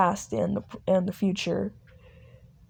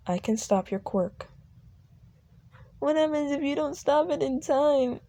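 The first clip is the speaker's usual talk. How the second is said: put-on higher, worried child's voice; scared little girl; 5 semitones higher than usual. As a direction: ask like a frightened child. Scared child asks Aizawa.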